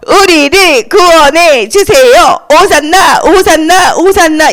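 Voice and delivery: fervent crowd chant; crowd shouting. Acted. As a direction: cry out in drawn-out syllables. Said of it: "이렇게 외쳤어요".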